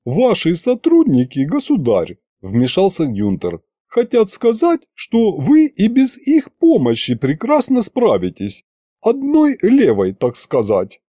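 high, ingratiating butler; smooth it over sweetly. A narrator's reading. Butler Gunter smoothing things.

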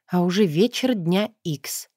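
usual speaking voice

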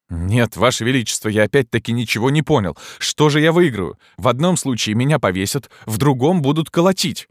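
quick, exasperated protest; exasperated poet. A narrator's reading. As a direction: argue back in disbelief. Gringoire protests.

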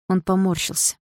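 usual speaking voice

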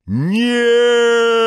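high, loud, drawn-out yell; bandits' yell. A narrator's reading. Bandits crying no as the rag flies to the barrel, yelled. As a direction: scream it out long and high. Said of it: "заорали бандиты".

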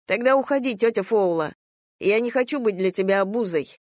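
put-on high, upset child's voice; hurt young boy. A narrator's reading. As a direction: push the aunt away as a wounded boy. Narrator voices hurt young nephew.

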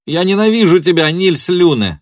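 loud, high cry of hatred; hateful outburst. A narrator's reading. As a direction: hurl hatred at him at full voice. She bursts out in hatred.

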